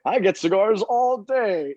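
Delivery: lightly voiced stuck-up lord, slowed down; a hint of a bored House of Lords peer; haughty lord. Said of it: "He's like".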